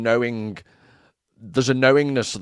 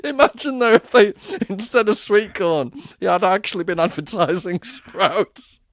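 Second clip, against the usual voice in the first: laughing; 7 semitones higher than usual. Real, not acted. Giggling at the sprouts idea.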